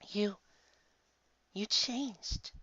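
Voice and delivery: high, breathless wonder; a small girl marvelling at the changed angel; amazed little girl. Acted. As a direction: gasp it out in awe.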